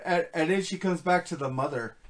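normal talking voice

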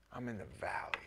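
hushed mock-despairing whisper; soft whispered lament; whispered self-pity. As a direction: breathe it out like a sorry complaint. Voicing the self-pitying believer.

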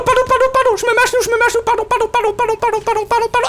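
frantic apologizing. Acted.

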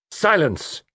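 high, ringing command; firm, authoritative. A narrator's reading. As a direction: ring out to command the room. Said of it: "firm and authoritative".

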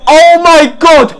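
loud, high, drawn-out outcry; exasperated shout. Real, not acted. Fan watching an attack wasted, exasperated shout. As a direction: stretch it out in exasperation.

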